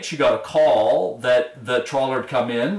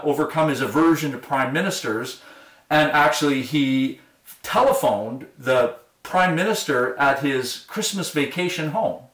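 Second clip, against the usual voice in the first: usual speaking voice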